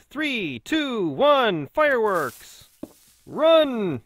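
loud, high countdown with falling calls; excited countdown shout. Real, not acted. Player counting down to the TNT blast, excited shout. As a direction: call it out loud and drawn out at the end.

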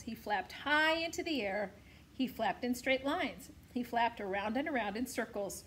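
plain reading voice

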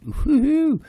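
high, loud whoop; loud mock-celebratory cheer; retold elation. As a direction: whoop it out, mocking your earlier optimism. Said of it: "I thought".

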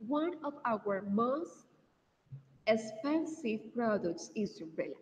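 "One of our most expensive products is umbrella" drawn out word by word, with pauses; careful teacher correction. Shown as a demonstration. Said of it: "we say".

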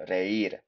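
"reír" with a distinct, stressed í; "reír" said carefully as re-ÍR; accented í pronounced clearly. Shown as a demonstration. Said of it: "So really pronounce that".

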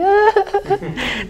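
high, soft wail of fake crying; hammed-up imitation of sobbing; dramatic mock sob. Herself crying after the scolding, dramatic mock sob. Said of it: "toda dramática".

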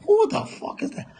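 fast, whispered repeat of the question. Pretend. Repeating the question to himself, fast whisper. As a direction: hiss it quickly under the breath.

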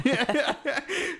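high burst of laughter. Real, not acted. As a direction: laugh at the memory. Laughing at own story.

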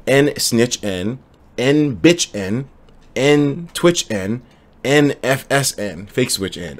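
slow, careful run-through of the rhyme; slow memorizing recital. Shown as a demonstration. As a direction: spell it out very slowly, testing the memory. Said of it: "I think I can memorize this".

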